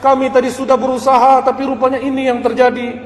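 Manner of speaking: high, loud lament to father; crying. Acted. Brothers weeping to their father about Bunyamin, high loud lament. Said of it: "Begitu pulang menangis".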